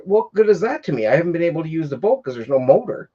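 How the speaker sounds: high, fast, exasperated retort; angry rant. Retold or imitated. To the neighbour about the trailer he cut, exasperated angry rant. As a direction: make him see the boat is useless to you. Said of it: "cursing him out".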